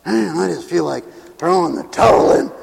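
mock-weary sigh of surrender; tired, unraised voice of defeat; weary giving up. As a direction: grumble it like someone worn out. Acts giving up, second take.

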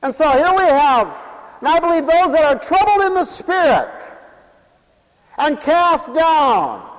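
high, loud proclamation; full-force preaching. Real, not acted. Preacher declares the text to the congregation, loud full-force preaching. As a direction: proclaim it loudly, stretching the last words.

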